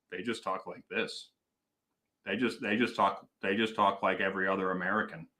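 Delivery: low, soft, neutral American accent; hushed, deadpan General American accent; accentless Madison voice. Shown as a demonstration. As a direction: imitate Madison people with no regional accent at all. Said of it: "Madison people don't have an accent".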